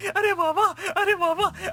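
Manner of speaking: ecstatic cheering. Acted. Overjoyed suitor cheers at the proposal, ecstatic. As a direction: cheer like you've won the lottery.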